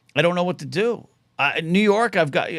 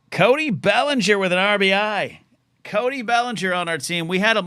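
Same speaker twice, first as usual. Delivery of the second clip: higher-pitched burst of excitement; excited; 6 semitones higher than usual. Real, not acted. Fantasy baseball manager spotting his player's success, excited. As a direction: call out the good news.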